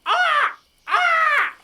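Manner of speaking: high shrill seagull squawks; gull cry. Acted. GM voicing the gull as it flies off, high squawks. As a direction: screech like a gull.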